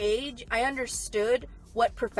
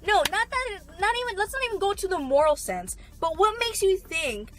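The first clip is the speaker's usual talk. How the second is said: high, loud outraged rant with a crying edge; furious rant; 5 semitones higher, 6 dB louder than usual. Real, not acted. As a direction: let the outrage pour out, nearly in tears. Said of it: "fucking rant over".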